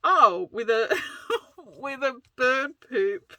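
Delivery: high, laughing through the words; laughing dismay. Retold or imitated. Friend finds the stain on stream, laughing high dismayed imitation. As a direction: crack up while discovering something gross. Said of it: "then she goes".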